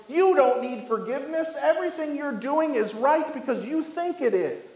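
high-pitched, sweeping proclamation; mock lion's roar. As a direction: cry it out high as the tempting lion. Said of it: "the lion cries out by roaring".

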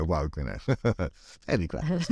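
ordinary talk, nothing marked

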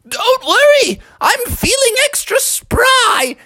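very high, drawn-out granny voice; high-pitched, confident old lady; plucky grandma. Acted. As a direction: reassure him as a spry cartoon grandma. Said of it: "Grandma was like".

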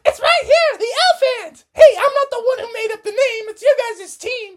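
high, squeaky cheerful mom voice; giddy team mom. Acted. Missus Frogerson cheering the boys on, high and giddy.

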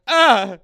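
loud, high exasperated "ah"; frustrated groan. Retold or imitated. Driver hitting every light, loud frustrated groan. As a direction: burst out in agonized frustration.

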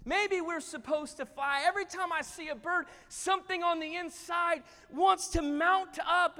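high, loud, rising urgency; eager pleading. Acted. Eagle tells his brother he feels meant to fly, urgently. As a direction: press your brother with a stirring hope.

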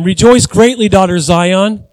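loud joyful proclamation; proclaiming scripture. Acted. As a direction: call it out like a herald. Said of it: "Zechariah nine nine".

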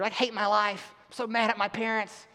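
a light mock of a sulky hormonal teenager; half-hearted whiny teen complaint; angry teenager. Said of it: "an angry teenager, hormonal teenager".

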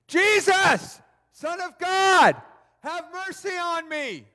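loud high-pitched cry for mercy; shouting blind beggar. Acted. Blind Bartimaeus calls out to Jesus, shouting. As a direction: call out high, stretching each phrase. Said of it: "he began to shout".

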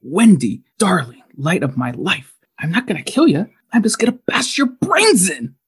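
sinister, rising menace; sweet then unhinged threat; creepy Jack Torrance. Acted. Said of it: "remembered how that inflection somewhat went".

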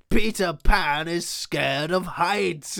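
loud, high, drawn-out jeer; gloating, stretched-out taunt to the crowd; mocking panto villain. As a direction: announce it slowly like a hammy pantomime villain. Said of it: "And he's like".

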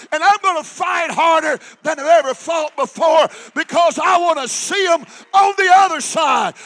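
loud, rising shouted sermon; preaching at full force. Acted. Preacher rallying the congregation, full-force shouted preaching. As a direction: build the congregation up, hammering each line.

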